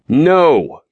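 flat final no; firm refusal. Acted. Father refuses his son, firm and final. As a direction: shut it down, no room to argue. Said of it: "tone brooked no argument".

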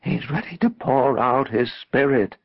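slow, high, wavering proclamation; prophetic waver. A narrator's reading. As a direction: announce it like a prophetess, drawn out. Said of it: "with a prophetic waver in her voice".

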